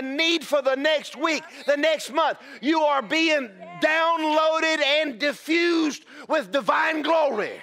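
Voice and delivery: fervent shouted preaching; preaching at full force. Real, not acted. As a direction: drive it home to the congregation. Preacher building to climax.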